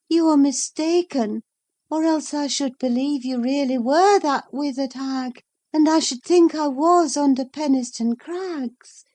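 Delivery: put-on high, dreamy sick young woman's voice; lost in a fevered vision, talking to her nurse; feverish Catherine. A narrator's reading.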